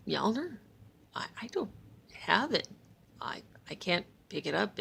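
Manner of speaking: soft, hesitant stammer; meek mumble. Acted. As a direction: play innocent and small. Loki voiced as meek liar.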